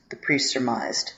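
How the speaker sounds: usual speaking voice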